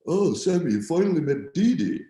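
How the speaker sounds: high, knowing teasing voice; amused Joey voice. Retold or imitated. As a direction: tease him that he knows. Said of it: "He's going".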